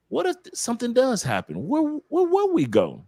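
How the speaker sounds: high-pitched, stammered worry; high mock-panicked questioning. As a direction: stammer it as someone caught without a plan. Acting out having no plan.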